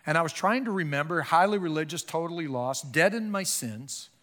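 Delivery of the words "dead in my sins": usual speaking voice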